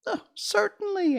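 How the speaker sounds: tiny mouse queen, very high and squeaky; squeaky little voice. A narrator's reading. Mouse queen greeting Dorothy's friends in a squeaky voice. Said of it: "in her squeaky little voice".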